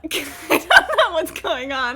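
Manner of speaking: laughing through confusion; laughing confused. Real, not acted. Streamer laughing, confused by the cutscene. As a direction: laugh while you say it.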